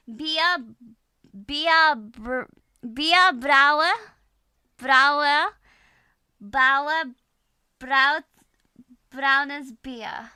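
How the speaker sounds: loud, halting German practice; German tongue twister. Shown as a demonstration. Struggling through a German tongue twister, loud halting German. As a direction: sound out the German words one at a time. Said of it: "Natural German".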